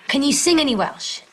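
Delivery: ordinary talk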